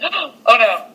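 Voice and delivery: playfully scared horror-film viewer; mock dread. Acts out scared viewer's reaction.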